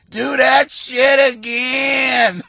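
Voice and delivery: put-on slow drawn-out shout of an eager old lady; yelling old lady. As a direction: holler it up at the stage like a thrilled old fan. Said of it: "she yelled up".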